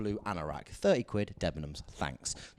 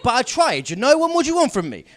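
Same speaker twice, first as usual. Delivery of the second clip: much higher and louder, laughing indignation; exasperated protest; 12 semitones higher, 10 dB louder than usual. Real, not acted. Comic defending his first aid to the audience, with loud laughing exasperation. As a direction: plead your innocence to the crowd, half laughing.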